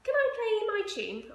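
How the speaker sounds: put-on high, loud eager kid; a little boy begging to play; high-pitched loud child asking. Child asks mother.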